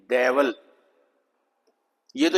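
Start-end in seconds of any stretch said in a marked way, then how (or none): 0.1-0.5 डेविल with a schwa, de-vuhl; correct pronunciation. Shown as a demonstration. Teacher corrects the word, saying डेविल softly. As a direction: say डेविल low with a weak second vowel. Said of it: "डेविल नहीं है ये".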